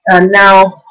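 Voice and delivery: ordinary delivery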